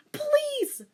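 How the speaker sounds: very high loud plea; pleading cry. Shown as a demonstration. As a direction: cry out please. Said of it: "Wait, no".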